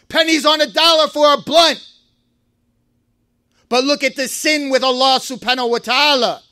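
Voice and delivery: ordinary delivery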